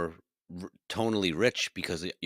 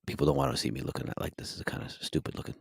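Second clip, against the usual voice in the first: low, softening close-mic voice; 6 semitones lower than usual. Shown as a demonstration. Co-host explaining mic distance, low close-mic voice. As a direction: talk low right into the microphone, easing to near whisper. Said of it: "the closer you get up on the mic".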